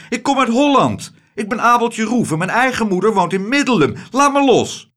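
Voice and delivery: high, loud and desperate; a boy struggling to break free; protesting boy. Acted. Abeltje pleads with the rich lady to let go, loud and high.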